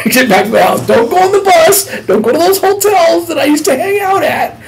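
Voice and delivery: loud, high-pitched mock panic; urgent self-scolding. Newly freed man ordering himself straight home, loud and high. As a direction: yell warnings at yourself, voice way up.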